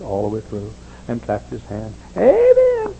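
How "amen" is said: very high, loud amen shout. Retold or imitated. Old pastor shouting amen alone, very high and loud. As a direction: holler the amen. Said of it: "he'd shout a while".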